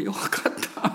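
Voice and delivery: breathed, voiceless よかった; a private sigh of relief to himself; inner relief. Retold or imitated. Pastor silently relieved as the son praises, breathed softly. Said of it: "私、心の中で".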